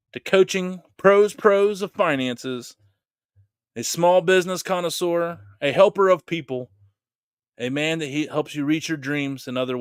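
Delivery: announcer intro. Acted. Host introducing tonight's guest, announcer style. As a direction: hype the guest like a ring announcer.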